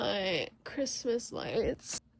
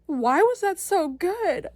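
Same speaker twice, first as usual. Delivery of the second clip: excited exclaim; 6 semitones higher, 5 dB louder, slower than usual. Real, not acted. Overwhelmed after the movie.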